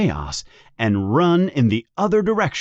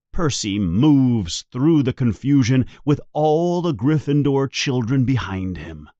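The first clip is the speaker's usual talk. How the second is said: plain reading voice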